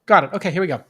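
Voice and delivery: ordinary talk, nothing marked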